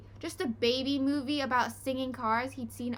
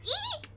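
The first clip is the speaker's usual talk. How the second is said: very high shriek; screamed eek; 5 dB louder than usual. A narrator's reading. Polly shrieking as the dish explodes. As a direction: shriek at the blast. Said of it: "screamed Polly".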